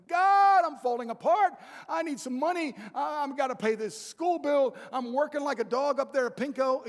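loud desperate plea, pitched high; crying out to God. Retold or imitated. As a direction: call out to God as if falling apart. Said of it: "I cried out to God".

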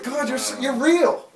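high, loud amazed declaration; awestruck cry to God. Retold or imitated. Preacher realising God is real, exclaiming in awe. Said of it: "I was just like".

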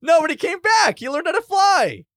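high, loud, laughing insistence; excited protest. Real, not acted. Player defending the dragon to the game master, loud protest. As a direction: argue back at the top of your voice.

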